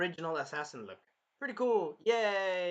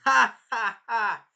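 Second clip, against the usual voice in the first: loud, high ha ha ha; hammed-up cackle; mock laughter; 6 semitones higher, 13 dB louder, much slower than usual. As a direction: laugh it out like a gloating villain.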